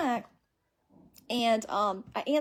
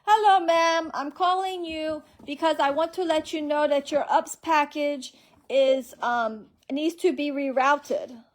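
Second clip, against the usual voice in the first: high, louder put-on caller voice; mock scam caller; 5 semitones higher, 4 dB louder, slower than usual. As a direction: ham up the fake polite scammer. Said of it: "And he says".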